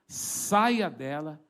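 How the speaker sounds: slow, low-pitched order, firmly weighted; low restrained command. Retold or imitated. Preacher ordering the demon out, low, slow and firm. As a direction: command slowly in a low register. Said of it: "Falei baixinho assim".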